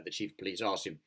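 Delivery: ordinary delivery, nothing marked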